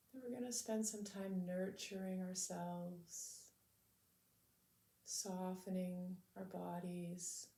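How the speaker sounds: calm, measured meditation voice with long pauses; unhurried, soothing lead-in to stillness; guided meditation. Real, not acted. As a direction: gently guide listeners to settle into the body.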